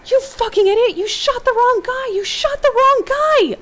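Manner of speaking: very high, loud screaming, words stretched; outraged yell. A narrator's reading. Riley yelling at the man who shot hero, outraged. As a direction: scream it in outrage, slowing as you go. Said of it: "you hear Riley's voice yell out".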